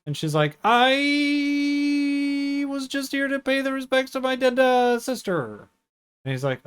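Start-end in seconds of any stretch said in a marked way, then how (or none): 0.6-5.7 high, stretched-out stalling; hammed-up nervous excuse in a high voice; awkward cover-up. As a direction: drag the first word out high, an obvious mock lie. Awkward lie voiced in retelling.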